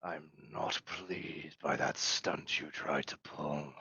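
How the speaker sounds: slow, low, soft Vesk captain voice; displeased reptilian captain. Acted. Vesk captain reproving the crew for a stunt, slow low displeasure. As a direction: draw it out quietly, a big reptile showing displeasure. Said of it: "he's looking at you, and he goes".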